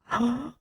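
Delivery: quick high gasp of delight; sharp delighted gasp. Real, not acted. Streamer gasps on seeing the celebration alert, delighted gasp. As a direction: catch your breath at a surprise.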